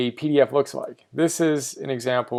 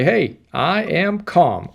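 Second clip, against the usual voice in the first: forced calm; 3 semitones higher, slower than usual. Shown as a demonstration. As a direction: say it like you're trying to soothe yourself.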